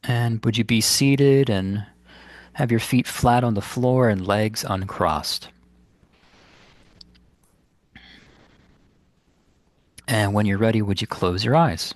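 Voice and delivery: unhurried phrases with long, restful pauses; guided meditation. Acted. Meditation teacher guiding listeners to ground the body, in guided meditation style. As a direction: guide them gently inward, leaving space between instructions.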